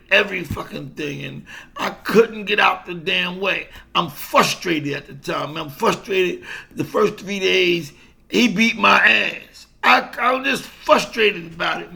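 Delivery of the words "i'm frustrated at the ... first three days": ordinary talk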